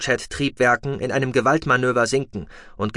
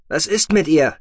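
a lightly raised, brisk voice for the pilot Tech; Tech's voice; 6 semitones higher, 5 dB louder than usual. A narrator's reading. Tech telling the captive noble they are leaving, lightly voiced. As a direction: voice Tech a touch higher and brighter than the narrator.

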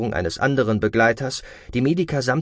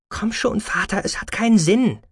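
much higher-pitched daughter reasoning with her father; Verbene, pleading reason; 11 semitones higher than usual. A narrator's reading. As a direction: urge him gently in a high voice. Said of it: "versuchte es Verbene mit Vernunft". (mit hoher Stimme vernünftig zuredend)

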